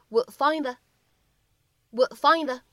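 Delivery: "would find the" slowly, both d's unreleased; linked phrase. Shown as a demonstration. Teacher modelling the whole linked phrase, slow. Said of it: "就会读成".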